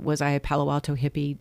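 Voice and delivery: ordinary talk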